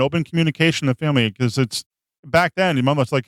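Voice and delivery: ordinary talk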